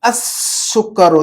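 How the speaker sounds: السکر as as-sukkaru, very slow with a long S; assimilated article. Shown as a demonstration. Teacher models for pupil, السکر slowly with long held S. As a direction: hiss the doubled S in السکر, no L. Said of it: "لام کی آواز کو غائب کر دیا ہم نے".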